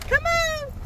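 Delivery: very high, drawn-out call; high cooing summons; coaxing pet call. Real, not acted. Calling the animals.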